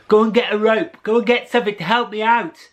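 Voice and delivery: high, loud, fast call for help; urgent call. Acted. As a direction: shout up out of the hole. Jack calls up from the hole.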